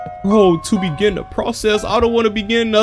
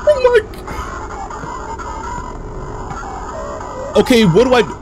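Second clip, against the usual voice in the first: high frightened cry; panicked; 9 semitones higher, faster than usual. Real, not acted. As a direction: panic after the scare. Jumpscare in horror game.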